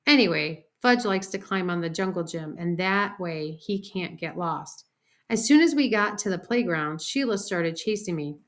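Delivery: ordinary delivery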